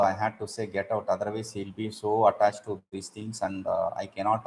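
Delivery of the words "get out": quiet, lower get out; soft compassionate get out. Shown as a demonstration. Teacher voices the kindness behind sending a disciple away, softly. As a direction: say it gently, with pity inside. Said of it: "but inside, this poor fellow".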